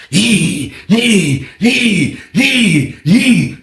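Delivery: high repeated "yee" squeals, evenly spaced; imitated door kicking. Inmate hears next door being kicked, imitated squeals. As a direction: mimic kick after kick on metal. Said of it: "I hear the door being kicked".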